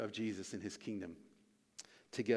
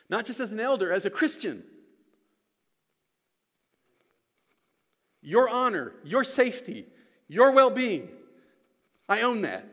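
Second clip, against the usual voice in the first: loud, raised, emphatic declarations; full-force preaching; 7 semitones higher, 8 dB louder than usual. Real, not acted. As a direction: declare it with conviction, pausing between phrases.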